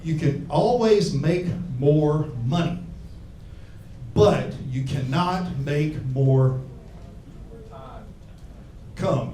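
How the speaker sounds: slow, weighty famous quote. Retold or imitated. Comic quoting a famous saying, slow and weighty. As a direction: deliver it slow like wise words. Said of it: "a famous guy who said".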